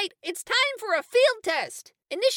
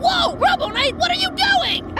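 higher, louder panicked cry; alarmed shout; 8 semitones higher, 4 dB louder than usual. Acted. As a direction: yell in alarm at the robot. Robot attacks them.

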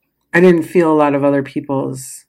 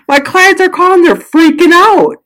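frantic; 14 semitones higher, 7 dB louder than usual. Real, not acted. Describing panicked clients.

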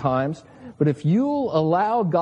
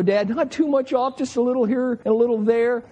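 put-on higher, pleading little boy voice; a boy begging his barber dad to cut less; pleading kid; 6 semitones higher than usual. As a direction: beg dad like a worried kid. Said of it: "And I'd always go".

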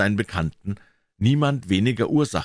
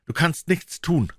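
put-on despairing old doctor; 10 semitones higher than usual. Lanyon answers despairingly.

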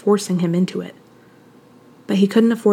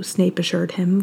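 usual speaking voice

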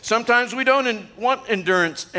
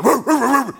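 mock chasing woo; 4 semitones higher, 4 dB louder than usual. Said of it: "I'm like".

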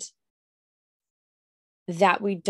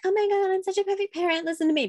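high, sarcastic self-satisfied voice; mock smug parent; 12 semitones higher, faster than usual. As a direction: parody a preachy perfect mom. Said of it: "So I'm not sitting here being like".

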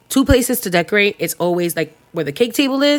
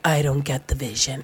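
low, quiet doubt; doubtful man; 7 semitones lower, 8 dB quieter than usual. Retold or imitated. Client mimicking Steven's skeptical remark, low and quiet. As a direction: mumble it low like an unconvinced man.

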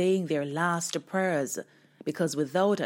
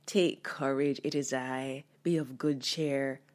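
gentle; 4 semitones lower, 4 dB quieter than usual. Shown as a demonstration. Jesus gently reassuring the frightened disciples on the water. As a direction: lower and softer, gentle comfort. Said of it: "Did he gently say".

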